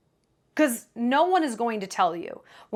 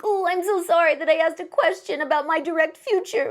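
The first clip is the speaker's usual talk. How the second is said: mock apologetic; 5 semitones higher than usual. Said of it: "by being like".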